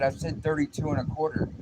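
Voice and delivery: high, loud retold correction; exasperated correction. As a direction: act out repeating it to someone not listening. Coworker repeats the measurement.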